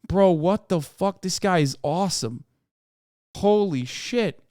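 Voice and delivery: usual speaking voice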